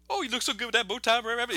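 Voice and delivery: high-pitched teasing fox voice; Brer Fox. Retold or imitated. Fox mocking rabbit while tying him up, high taunting voice. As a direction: taunt the tied-up victim in a high voice. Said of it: "he's like".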